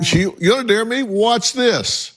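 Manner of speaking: high-pitched acted comeback; mock challenge. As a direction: answer back as if taking up a dare. Acts out authorities answering the defiance.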